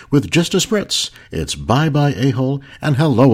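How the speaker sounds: loud, punchy announcer read; old radio announcer. Acted. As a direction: sell it like a vintage radio ad. Podcast intro and mock ads.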